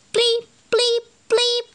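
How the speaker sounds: high electronic bleep sounds; computer bleeps. Acted. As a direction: bleep like a game console. Computer noise in story.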